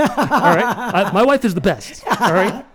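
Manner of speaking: loud, high, hammed-up declaration; playful over-the-top boasting; mock-vehement devotion. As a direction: proclaim it as if daring anyone to argue. Hammed-up boast of love for wife.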